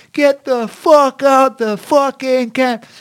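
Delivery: high, drawn-out shouted order; drill-sergeant yell. Retold or imitated. Camp instructor rousing men who overslept, high drawn-out yell. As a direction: bellow it slowly, stretching every word. Retelling a wake-up call at camp.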